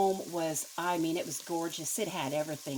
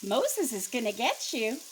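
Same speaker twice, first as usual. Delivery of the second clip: parrot squawk; 5 semitones higher than usual. Acted. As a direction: screech it like a guard parrot. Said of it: "all of a sudden".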